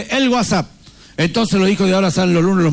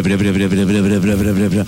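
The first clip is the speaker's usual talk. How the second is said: low rumbling bre bre of a truck; engine noise; 11 semitones lower than usual. Retold or imitated. Imitating father's jeep approaching, low engine rumble.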